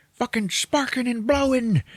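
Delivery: high, strained excited telling; hammed-up alarm. Telling of the engine sparking, hammed-up frenzy. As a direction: act out the chaos in a high voice. Describes engine going haywire.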